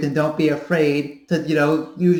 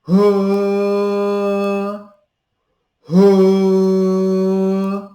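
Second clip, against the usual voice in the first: high ha sounds, some louder; demo sounds; 3 semitones higher, 5 dB louder, much slower than usual. Shown as a demonstration. Coach demonstrating ha sounds. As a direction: repeat the ha sound to find the voice. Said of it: "let me show you kinda what the, uh, voice sounds like without".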